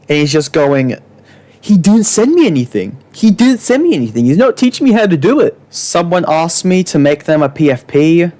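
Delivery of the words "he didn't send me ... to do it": high, swooping mock complaint; put-on high whiny protest; mock whining. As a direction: imitate a whiner, quickening as it repeats. Mocking Hyper's repeated complaints.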